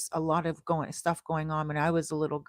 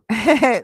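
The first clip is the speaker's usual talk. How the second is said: loud, high burst of laughter; real quick laugh; amused giggle; 5 semitones higher, 10 dB louder than usual. Real, not acted. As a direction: giggle out loud at a cheeky comment. Amused by a viewer's comment.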